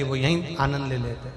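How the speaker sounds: ordinary delivery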